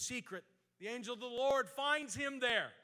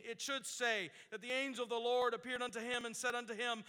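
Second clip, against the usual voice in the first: ordinary talk, nothing marked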